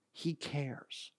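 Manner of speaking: whispered, hushed close. Real, not acted. Preacher to congregation, whispered close. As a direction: breathe it out as a whisper. Sermon climax after repetitions.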